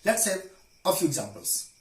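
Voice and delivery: ordinary delivery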